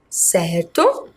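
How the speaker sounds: normal talking voice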